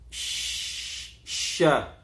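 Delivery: a voiceless "sh-" hiss, then "sha" with the tongue mid-mouth; demo of the sh sound. Shown as a demonstration. Teacher showing students the shin sound, hissed then voiced. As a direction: breathe out "sh-" and open it into "sha". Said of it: "Jadi bunyinya".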